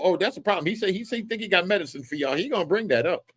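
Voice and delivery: usual speaking voice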